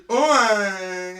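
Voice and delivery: loud, long-drawn comic Scottish "aye"; facetious Scottish. Shown as a demonstration. Friend showing how their bit goes, facetious Scottish. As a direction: stretch out a jokey stage-Scot "oh, aye". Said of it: "when you and I are doing a bit, it's usually".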